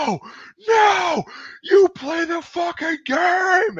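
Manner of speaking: imitated furious high-pitched yell; mock a commander screaming in rage; Waz flipping out. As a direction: stretch out each word in an outraged holler. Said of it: "Waz flipped the fuck".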